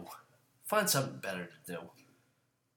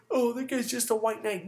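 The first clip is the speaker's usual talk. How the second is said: high, loud whiny mock voice; mocking critic; 13 semitones higher, 5 dB louder than usual. Host quoting his critics, mocking them in high voice. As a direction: imitate a sneering critic, pitched way up. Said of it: "you say, you know".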